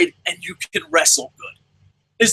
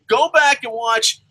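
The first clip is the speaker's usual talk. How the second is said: normal talking voice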